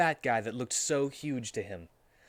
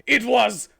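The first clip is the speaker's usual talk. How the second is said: very loud, high shrieking accusation; crazy scream; 11 semitones higher, 12 dB louder than usual. Acted. Amy accuses Shadow of his crime, full scream. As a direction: scream it like a madwoman. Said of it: "screamed Amy".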